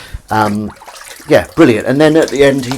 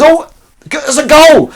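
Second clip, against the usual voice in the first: high, loud goal commentary yell; Partridge; 11 dB louder, slower than usual. Retold or imitated. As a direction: erupt like an overexcited sports reporter. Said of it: "and he goes".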